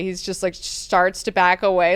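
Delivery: ordinary talk, nothing marked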